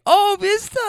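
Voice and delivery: high-pitched mock cry of an excited lady; hammed-up squeal of delight. Movie lady calling out to Mister, mock excited.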